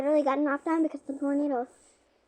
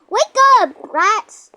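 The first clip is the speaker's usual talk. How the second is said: high, loud wake-up yell; 7 semitones higher, 14 dB louder, much slower than usual. Pretend. Girl waking her siblings, high loud yell. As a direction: holler them out of bed.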